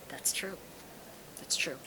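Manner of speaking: soft, inward murmur dropping to a whisper; quiet realization. Retold or imitated. Voicing her own quiet thought.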